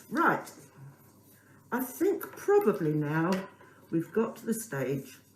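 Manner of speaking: normal talking voice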